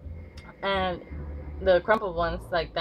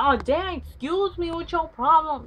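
high mock sassy snap at someone; tough girl attitude; 9 semitones higher, 3 dB louder, slower than usual. Tough girl to whoever bumps her, high sassy mock voice. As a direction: play the bad girl who takes offence at a bump.

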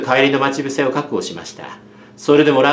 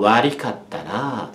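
lower, softer, slow apology; boy apologizing to a sobbing girl; 4 semitones lower, 6 dB quieter, slower than usual. A narrator's reading. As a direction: say it gently to her back. Kozo apologizing to crying girl.

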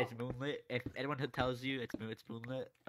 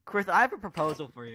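excited pitch; 5 semitones higher, 8 dB louder than usual. Acted. Pitching a joke proposal.

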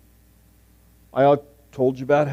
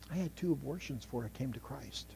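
much softer, hushed admission; soft confession; 18 dB quieter than usual. Retold or imitated. Friend confessing to him in the yard, hushed. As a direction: let it drop to a hush. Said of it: "she says".

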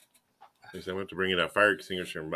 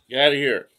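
dog's voice; 6 semitones higher, 5 dB louder than usual. Retold or imitated. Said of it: "She's like".

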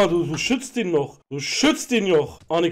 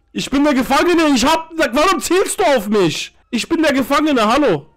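raised, higher-pitched indignant voice; worked-up, high-pitched outcry; agitated protest; 7 semitones higher, 4 dB louder than usual. Pretend. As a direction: protest loudly that you are wronged. Prisoner protesting at being aimed at.

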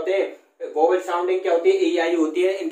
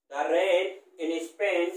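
slow chunked reading; much slower than usual. Shown as a demonstration. Teacher dictates the assonance example slowly to students. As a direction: dictate phrase by phrase, hitting the ay vowels. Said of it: "यानी रेन, Spain".

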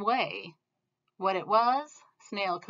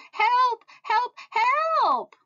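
panicked cries for help; 5 dB louder, much slower than usual. Acted. Ants panic in the rain.

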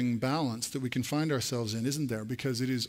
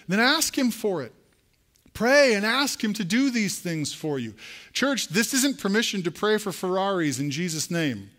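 higher and louder, driving the call home; rally the church like a passionate pastor; preaching at full force; 6 semitones higher, 4 dB louder than usual. Acted. Preaching climax.

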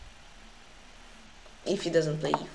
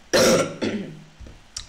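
loud chesty cough; 7 dB louder than usual. Real, not acted.

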